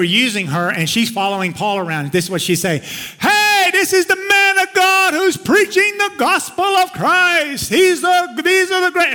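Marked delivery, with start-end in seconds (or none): 3.2-9.1 high, loud mocking shout of a possessed girl; mocking slave girl. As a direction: taunt him from behind in a high, shrill shout. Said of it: "it was almost in a mocking way".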